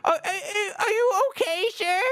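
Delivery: put-on high, quavering old lady voice; a kindly grandma checking on a crying kid; high trembling granny voice; worried grandma.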